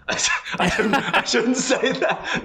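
high, laughing through I shouldn't say that; laughing guiltily. Real, not acted. Guest laughing at her own jab at Singapore. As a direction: giggle through the confession, caught out. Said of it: "Oh my God, yes".

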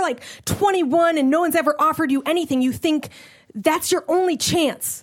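normal talking voice

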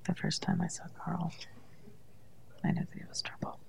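barely-moving-mouth whisper. Shown as a demonstration. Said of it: "Just have to whisper".

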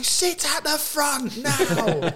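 high, drawn-out holler; mock order. Teammate ordering the keeper to the front, high mock command. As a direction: call the order slowly in a high voice.

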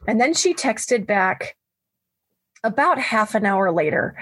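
ordinary talk

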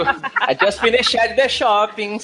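slow mock Brazilian accent in English; laughing Brazilian-accented imitation; Brazilian patricinha. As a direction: play a Brazilian rich girl speaking English. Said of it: "brasileira e, e patricinha também".

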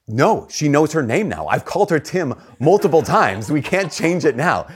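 high, insistent objection; acted-out childish protest; protesting kid. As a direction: plead the case like an upset child, stretching words. Child protests renaming the dog.

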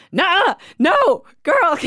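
loud, high, drawn-out Southern protest; slow, insistent Delta-accented scolding; thick Mississippi accent. Retold or imitated. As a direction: imitate a tipsy Mississippi friend calling you back. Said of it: "It's so thick, especially when she starts drinking".

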